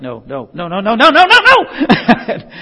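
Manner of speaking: rapid-fire no's building to a high shout; mock mom scolding. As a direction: start at talking level and escalate into a comic yell. Imitating moms scolding kids.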